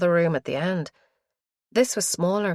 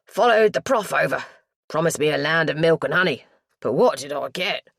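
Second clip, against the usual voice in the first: put-on very broad East End accent; thicker London accent; 5 dB louder than usual. A narrator's reading. East End lad tells why he moved, thick London accent. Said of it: "He dropped into a stronger accent".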